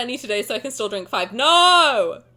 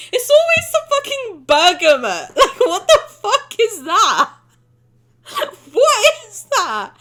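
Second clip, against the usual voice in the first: laughing rant; 8 semitones higher, 7 dB louder than usual. Real, not acted. Streamer ranting about wine notes, loud laughing rant.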